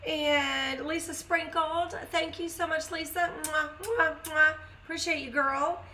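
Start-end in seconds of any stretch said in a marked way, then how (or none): 3.4-4.5 blown kisses. Real, not acted. Blowing kisses to a viewer.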